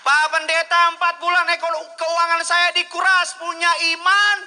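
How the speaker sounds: high, loud preacher's cry; loud preached testimony. Retold or imitated. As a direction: shout the testimony out to the crowd. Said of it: "testimony quoted, preached at full force". (bersaksi dengan suara lantang dan tinggi)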